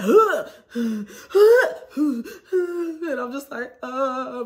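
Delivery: loud, high groaning breaths; hammed-up sick moans; imitated pained groaning. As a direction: groan like you're sick in bed.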